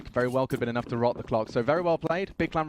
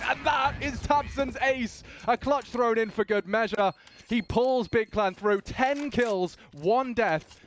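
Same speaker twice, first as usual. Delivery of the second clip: high, slow triumphant call; excited call; 9 semitones higher, slower than usual. Real, not acted. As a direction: crow over the ace. Ace call.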